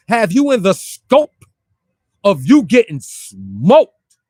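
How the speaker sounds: loud, drawn-out rhyming punchline; taunting, stretched-out loud delivery; emphatic taunt. Real, not acted. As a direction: punch the rhyme out loud and slow. Repeats his rhyme for effect.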